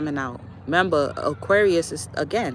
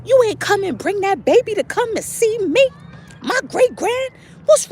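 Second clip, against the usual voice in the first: high, loud mock scolding grandma; playful church-lady scolding; scolding elder; 5 dB louder than usual. Elder scolds girl for hiding baby, high and loud. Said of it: "and said".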